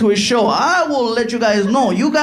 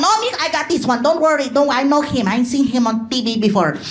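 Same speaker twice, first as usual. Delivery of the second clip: higher, confident mom voice; pushy mother; 4 semitones higher than usual. Acted. Mother calling out Jay Leno backstage, higher and brazen. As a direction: take charge like a brazen mom.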